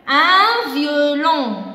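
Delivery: very slow, loud and drawn out; slow sounding-out. Shown as a demonstration. Teacher checking a word's sound with children, slowly sounded out. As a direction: stretch each syllable for young learners.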